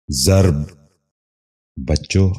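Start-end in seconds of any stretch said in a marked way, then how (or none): 0.1-0.5 ضرب in one syllable, "zarb"; correct pronunciation. Shown as a demonstration. Teacher giving viewers the model, correct pronunciation. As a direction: say ضرب as zarb, no second vowel. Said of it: "دوبارہ سنیے".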